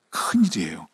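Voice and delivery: hushed aside, breath only; whispered warning. Acted. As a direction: let it slip out as a worried whisper. Preacher drops to a whisper.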